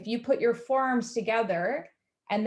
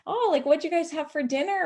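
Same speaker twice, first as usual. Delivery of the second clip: higher-pitched, offhand fishing question; sneakily casual; 7 semitones higher than usual. Retold or imitated. Therapist slyly asks clients about their diet, sneakily casual. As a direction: imitate innocently making small talk to pry. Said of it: "I sneakily kind of find out these things".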